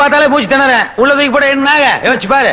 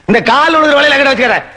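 usual speaking voice

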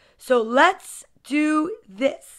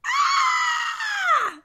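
long, very high-pitched shriek; high scream. Acted. Storyteller reacts to the picture book, high scream. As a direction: let out one long high squeal.

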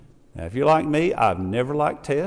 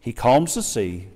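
normal talking voice

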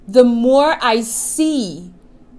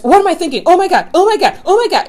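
high, loud mock panic; imitating someone's spiralling worry; panicked; 7 semitones higher, 4 dB louder, faster than usual. Mocking anxious thinkers in a higher, louder panic. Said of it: "they get all this anxiety".

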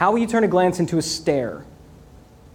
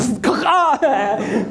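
real laugh; 6 semitones higher than usual. Real, not acted. Laughs after joke.

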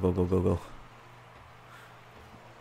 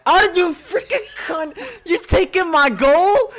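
high, raised-voice accusation; mock-outraged accusation; 7 semitones higher than usual. Real, not acted. Player whose goal got taken, playful outrage. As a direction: accuse your teammate in high indignation.

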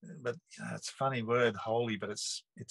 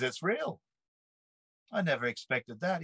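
higher, swooping imitation of an amazed mate; lightly acted wonder, pitched up; retold astonishment; 6 semitones higher than usual. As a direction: voice a friend's dawning disbelief. Friend's amazed reaction, acted in retelling.

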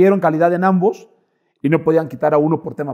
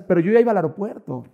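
usual speaking voice

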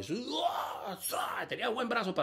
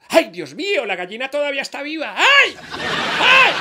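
shrieking in fright; 9 semitones higher, 6 dB louder than usual. Acted. Mother screams at live chicken.

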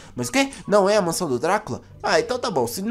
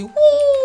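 excited whoop; 8 dB louder than usual. Acted. Jumps into the fountain.

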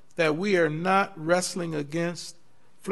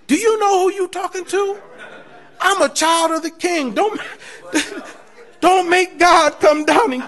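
higher, louder, laughing bravado; imagined comeback to a threatening coworker, laughing; mock bold retort; 9 semitones higher, 8 dB louder than usual. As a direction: play it like a defiant child of the King. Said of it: "I should have said".

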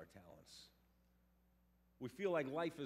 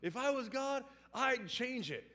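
higher, loud preacher voice rising to a shout; pastor preaching; 10 semitones higher, 11 dB louder than usual. Retold or imitated. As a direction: proclaim it from the pulpit, building. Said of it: "He goes".

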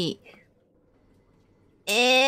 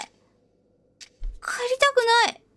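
whiny; 12 semitones higher, much slower than usual. Real, not acted. Scared of game, begging to leave.

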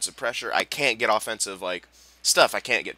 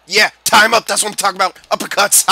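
loud, high crowing; triumphant shout; 7 semitones higher, 11 dB louder than usual. Real, not acted. Gamer landing uppercuts in a fight, triumphant shouting. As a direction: whoop it up over a won fight.

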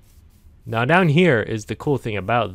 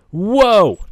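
amazed whoa; 11 semitones higher, 11 dB louder than usual. Real, not acted. Discovers a huge cave.

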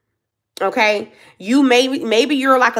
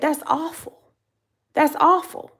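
higher, appalled disbelief; a retold gasp of outrage, pitched up; mock appalled; 8 semitones higher than usual. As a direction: say it like you can't believe it. Retold reaction to mom.

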